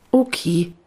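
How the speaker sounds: ordinary delivery, nothing marked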